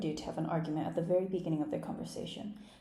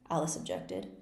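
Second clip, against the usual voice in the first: ordinary delivery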